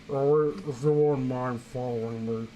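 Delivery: lightly voiced fat cop. Reading a story, lightly doing a fat cop. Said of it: "fat voice".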